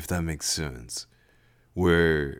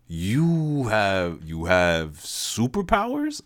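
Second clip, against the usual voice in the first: incredulous; 2 semitones higher, much slower than usual. Acted. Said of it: "I offered incredulously".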